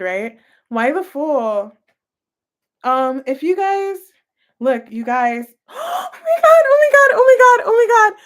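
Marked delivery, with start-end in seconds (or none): 5.6-8.2 gasping, crying out much higher and louder; real shock breaking into a shout; overwhelmed gasp. Real, not acted. Tarot reader stunned by the cards, crying out high and loud.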